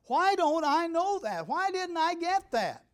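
high, slow, drawn-out frustrated questioning; exasperated self-reproach. Acted. Older learner scolding himself over the Bible, acted exasperation. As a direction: wail it in mock exasperation at yourself.

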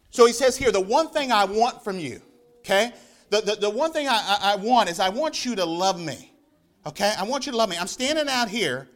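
loud, ringing preacher's voice; fervent preaching. Acted. Preacher urging his congregation, fervent and loud. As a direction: preach it out to the whole church.

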